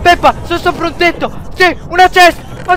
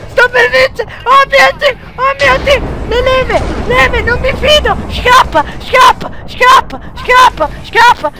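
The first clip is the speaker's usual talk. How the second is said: high, crying-out panic; frantic excited shrieking; 8 semitones higher than usual. Real, not acted. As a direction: shriek with near-win panic. Sta per vincere, urla. (grida acute di eccitazione e panico)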